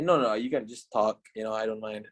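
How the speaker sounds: ordinary talk, nothing marked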